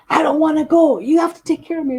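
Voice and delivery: put-on high, loud whiny elderly mother; mimicking her mother pleading not to go; imitating mom. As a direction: whine it like a stubborn old mom refusing. Mother refusing the nursing home.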